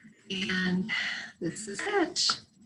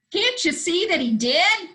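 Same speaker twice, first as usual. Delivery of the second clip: squawked; 8 semitones higher, 4 dB louder than usual. Acted. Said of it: "squawked the goose".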